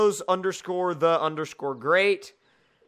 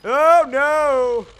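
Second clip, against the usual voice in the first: loud high "oh no" as the tractor tips; sudden shout of alarm at the crash; alarmed cry; 8 semitones higher, 11 dB louder than usual. Real, not acted. Gamer yelling at his tractor tipping over.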